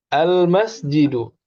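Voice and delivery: al-masjidu said slowly and carefully for repeating; model word. Shown as a demonstration. Teacher models a word to be repeated, slowly. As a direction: draw out al-masjidu for the class. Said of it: "ikuti setelah saya".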